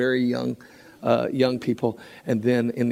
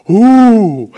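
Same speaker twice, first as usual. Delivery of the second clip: high, loud delighted ooh; surprised ooh; 8 semitones higher, 13 dB louder than usual. Retold or imitated. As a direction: react with a big surprised ooh. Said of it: "I'm like".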